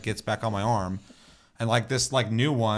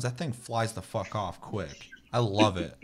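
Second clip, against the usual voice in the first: normal talking voice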